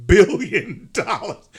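slow, laughing disbelief; incredulous laughter. Real, not acted. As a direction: draw it out through real laughter. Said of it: "I can't say it without laughing".

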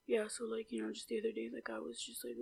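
very soft, barely audible mumble; imitated mumbling. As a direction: mumble it under your breath, imitating him. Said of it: "the guy was talking like this".

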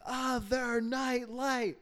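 put-on high, slow, drawn-out kid wail; imitated little daughter. Daughter calls for the other night light, imitated whining. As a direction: whine it out like a child in bed. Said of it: "And she's like".

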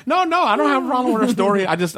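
fast, high, amused protest. Real, not acted. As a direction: rush to explain. Quick laughing protest.

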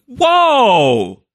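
loud, high stunned exclamation; slack-jawed amazement. Acted. Player voicing Billy watching someone walk off, slack-jawed amazement. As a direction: let out a big awestruck shout. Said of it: "slack-jawed at this".

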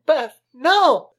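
high, loud scream of Beth's name; screaming warning. Retold or imitated. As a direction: shriek it to stop her. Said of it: "Amanda tries to scream".

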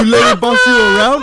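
loud taunt. Retold or imitated. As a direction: shout the jab. Retold taunt, much louder.

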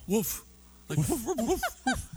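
high-pitched imitation woofs; playful dog bark. Shown as a demonstration. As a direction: bark the word like an excited dog. Imitates a dog saying the title word.

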